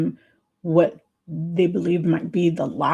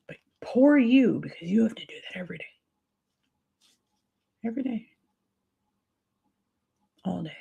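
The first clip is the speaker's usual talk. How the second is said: breathy, voiceless pity, fading softer; pitying whisper; 3 semitones higher, 4 dB quieter, faster than usual. Retold or imitated. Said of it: "That's what my brain rationalized".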